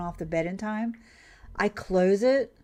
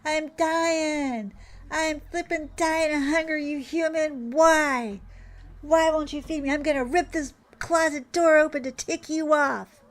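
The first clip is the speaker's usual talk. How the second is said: higher, whiny starving cat; a cat demanding breakfast, played higher and sassy; 7 semitones higher than usual. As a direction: voice the cat's dramatic complaint, pitched up. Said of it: "This is the sass I get".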